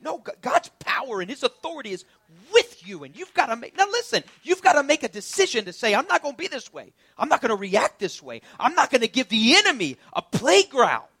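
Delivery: loud, high preaching climax; full-force preaching. Acted. Preacher rousing the church to take authority, loud and high. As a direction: shout it over the congregation.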